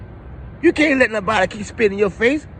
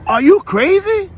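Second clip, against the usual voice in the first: incredulous outburst; 12 semitones higher, 6 dB louder than usual. Real, not acted. Rant at passive listeners.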